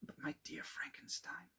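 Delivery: soft, almost whispered; hushed concern. Acted. Clerval suddenly noticing how ill his friend looks, hushed. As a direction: drop the voice as worry sets in.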